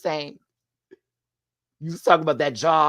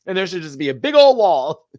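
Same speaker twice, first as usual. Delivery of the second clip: louder, higher mock declaration; joking outburst; 6 semitones higher, 9 dB louder than usual. Vlogger loudly jokes about a wall between queens. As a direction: belt it out as a joke.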